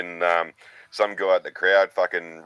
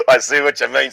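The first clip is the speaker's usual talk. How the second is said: higher, louder, through a laugh; laughing reply; 6 semitones higher, 8 dB louder than usual. Retold or imitated. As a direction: blurt it out while still laughing. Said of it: "he's come back with".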